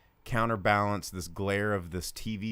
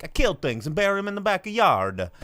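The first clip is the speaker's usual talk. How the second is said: mock Italian accent, high and loud; hammed-up Italian father confessing; Robert De Niro; 10 semitones higher, 7 dB louder than usual. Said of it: "Robert De Niro's like".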